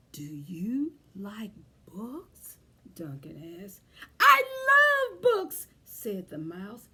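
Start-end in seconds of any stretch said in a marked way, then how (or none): 0.1-2.6 quiet ask. Acted. Duncan asks the mouse quietly. 4.2-5.7 high, loud little mouse voice; squeaky mouse voice. Acted. Mouse telling Duncan it loves books, high and loud.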